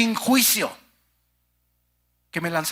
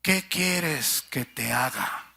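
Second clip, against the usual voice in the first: Jesus answering gently; 6 semitones lower, slower than usual. Acted. Jesus turning to the blind beggar, gently asking. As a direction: ask it kindly and slowly, like a gentle master. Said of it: "Respondiendo Jesús le dijo".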